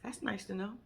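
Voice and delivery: mimicking his flat, low, quiet reply; deadpan low mumble, unimpressed; flat deadpan mimic. Mocking the husband's cold reply, low and deadpan. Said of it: "his only response to you was".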